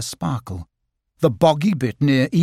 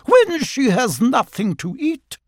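high, imperious complaint; haughty otter; 11 semitones higher than usual. A narrator's reading. Otter demanding something to eat, haughty. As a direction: put on a high, grand, affronted voice. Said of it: "she added in a haughty voice".

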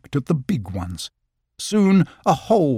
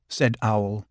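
usual speaking voice

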